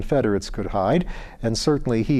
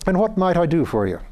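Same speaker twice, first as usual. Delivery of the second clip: higher, friendly Irish lord; Irish accent; 6 semitones higher than usual. Acted. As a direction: offer help in the lord's Irish lilt. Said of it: "He spoke with an Irish accent".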